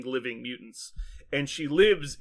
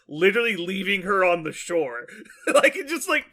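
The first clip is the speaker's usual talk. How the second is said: laughing; 5 semitones higher, 4 dB louder than usual. Real, not acted. Laughing while retelling the scene.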